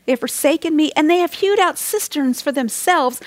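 usual speaking voice

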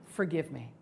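a prayer that starts soft and fades to almost nothing; humble confessing prayer. Pretend. Preacher praying for forgiveness in the grocery line, fading into a hush. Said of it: "I can look at her and I can say".

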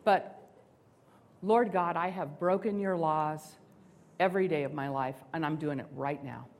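a prayer that starts soft and fades to almost nothing; humble confessing prayer. Pretend. Preacher praying for forgiveness in the grocery line, fading into a hush. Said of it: "I can look at her and I can say".

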